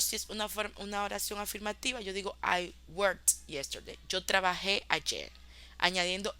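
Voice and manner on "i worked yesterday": slow, clear English example for learners; careful classroom pace; slow model sentence. Shown as a demonstration. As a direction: say it slowly so students catch it. Teacher models affirmative example sentence.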